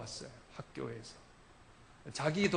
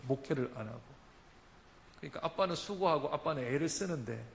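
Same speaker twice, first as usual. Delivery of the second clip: ordinary talk, nothing marked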